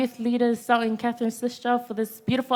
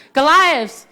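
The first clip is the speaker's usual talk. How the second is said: loud, raised preacher's call; call-and-response shout; 3 semitones higher, 7 dB louder than usual. Real, not acted. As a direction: call it out to rouse the congregation.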